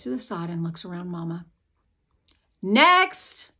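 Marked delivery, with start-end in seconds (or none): 2.6-3.5 loud, high call for the next person. Acted. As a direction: call over the mother's head. Clerk calls the next person.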